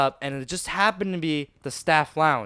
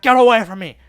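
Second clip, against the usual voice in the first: loud, higher-pitched defensive yell; shouted warning; 5 semitones higher, 10 dB louder than usual. Retold or imitated. Third grader fending off small attackers, shouted warning. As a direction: drive them back with a shout. Bigger boy fending off the group.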